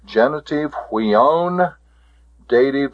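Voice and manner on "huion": "huion" with a long O; pronunciation demo. Shown as a demonstration. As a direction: model "huion", long final vowel. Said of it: "The pronunciation of this".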